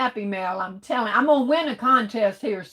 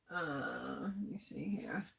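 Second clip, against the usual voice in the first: breathy whisper to herself, far softer; whispered aside; 5 semitones lower, 17 dB quieter than usual. Real, not acted. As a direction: mutter it under your breath while searching. Muttering to herself while scrolling chat.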